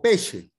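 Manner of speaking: Italian "sh" inside pesce. Shown as a demonstration. Teacher gives student a second example, pesce with "sh". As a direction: model pesce with sc said as "sh". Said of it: "ese es un sonido que suena sh".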